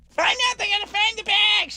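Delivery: high, squeaky mock of Nick's confidence. Husband mocks Nick's faith in the airline, high and squeaky. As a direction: pitch way up and parody the husband's trust. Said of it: "And Nick's like".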